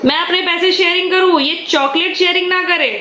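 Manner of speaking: mock grievance. Real, not acted. Mock complaint about son.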